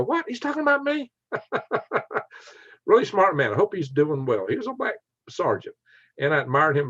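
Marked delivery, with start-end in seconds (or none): 0.0-1.1 high-pitched imagined double take; mock surprise. Imagines sergeant stumbling on his channel. 1.3-2.2 high, airy chuckle; amused laugh. Real, not acted. Laughs at his imagined scene.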